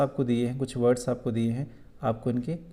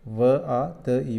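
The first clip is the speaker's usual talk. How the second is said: each letter said slowly and apart; slow spelling of a word, letter by letter; spelled out slowly; 4 dB louder, much slower than usual. Shown as a demonstration. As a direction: sound out each letter for the class. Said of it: "दूसरा बनेगा वादी".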